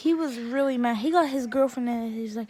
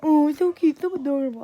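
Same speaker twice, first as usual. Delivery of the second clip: put-on babyish parroting, louder at the start; mocking mimicry; 2 semitones higher, 6 dB louder than usual. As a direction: throw the coo back in a mock baby voice. He mimics her coo back.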